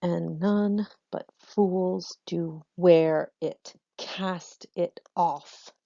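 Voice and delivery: very slow, each syllable marked; slow metrical verse reading; scanning the verse. Shown as a demonstration. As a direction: read the line beat by beat, slowly. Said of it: "so I'm going to use that".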